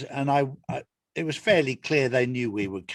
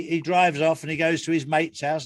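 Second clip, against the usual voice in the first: ordinary delivery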